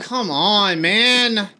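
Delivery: loud, high exasperated groan at the game; frustrated shout. Real, not acted. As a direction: yell it out in frustration. Frustrated at missed kill.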